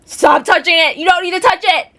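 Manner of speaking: high, loud retold teenage outburst at mom; exasperated yelling. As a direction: imitate yelling at a parent to back off. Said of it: "I would just sit there and be like".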